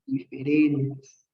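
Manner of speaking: "diferentes" drawn out slowly, low and soft; slow, deliberate "diferentes" to make it sink in; key-word emphasis. Shown as a demonstration.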